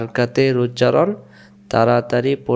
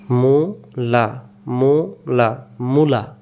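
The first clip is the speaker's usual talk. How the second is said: slow drill; slower than usual. Shown as a demonstration. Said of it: "reading drill demo".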